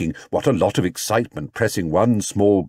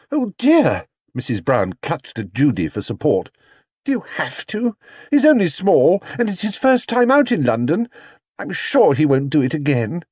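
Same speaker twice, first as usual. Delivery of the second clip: anxious pleading; 7 semitones higher than usual. Acted.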